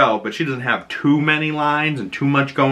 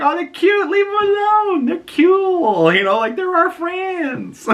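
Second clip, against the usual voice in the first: put-on very high, babyish pleading; mock cooing plea. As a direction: coo protectively, way up in pitch. Acted cooing defence of the creatures.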